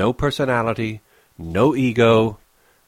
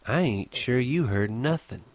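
cool; 3 semitones higher, 6 dB quieter than usual. Acted. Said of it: "She appraised him coolly".

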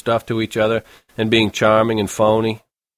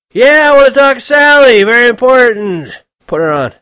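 put-on drunken shouting; 10 dB louder than usual. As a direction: slur it loud into the phone, very drunk. Drunk Holden insisting.

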